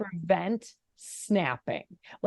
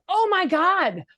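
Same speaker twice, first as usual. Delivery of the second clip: much higher, louder exasperated cry; exasperated shout; 11 semitones higher, 6 dB louder, slower than usual. Retold or imitated. Mother shouting at her son in the car, exasperated. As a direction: blurt it out fed up. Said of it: "I was mad".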